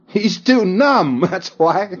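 half-joking drawn-out scorn; mock numb drawl. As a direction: stretch it out like you're mocking a dull guy. Mocking numb Joe.

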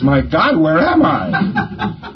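slow, dazed, laughing cry of surprise; a stunned man just yanked out, drawn out; startled and lost. Retold or imitated. Man popped out of his body, stunned and laughing. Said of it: "The guy says".

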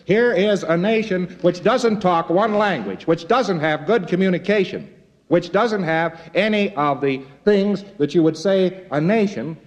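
ordinary delivery

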